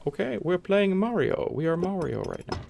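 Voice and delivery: high-pitched, hammed-up bad Italian accent; Mario. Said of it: "that's not Mario's accent".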